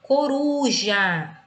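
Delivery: "Coruja" very slowly, with RU drawn out; stress on middle syllable. Shown as a demonstration. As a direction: stretch and punch "ru" in "Coruja". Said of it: "ela é a penúltima".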